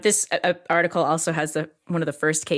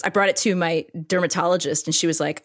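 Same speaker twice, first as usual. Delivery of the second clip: usual speaking voice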